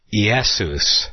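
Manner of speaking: "Jesus" said slowly as "ee-YEH-soos"; Greek-style pronunciation. Shown as a demonstration. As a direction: open "Jesus" on a vowel, ee-EH-soos, no J. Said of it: "of the Savior pronounced as".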